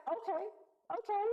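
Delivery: high, clipped upset okays; upset mom. Retold or imitated. Mom caught off guard on the phone, upset. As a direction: mimic mom getting rattled. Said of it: "You see her getting all upset".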